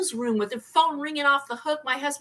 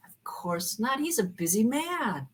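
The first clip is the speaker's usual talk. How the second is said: ordinary talk, nothing marked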